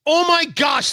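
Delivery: thrilled exclamation; excited outburst. Real, not acted. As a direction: shout out your delight at the clip. Host reacting to hearing clip.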